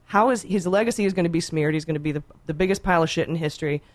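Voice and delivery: usual speaking voice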